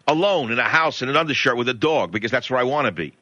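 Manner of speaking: usual speaking voice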